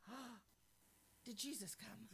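soft, gasped mock alarm; gasp, then ask it softly, playing startled; retold startled gasp. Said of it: "So the first thing I think of".

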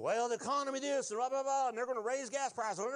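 high-pitched, dismissive parody of complainers; mock worrying. Preacher imitates people fretting over the economy, mockingly. Mocks what worriers say about economy.

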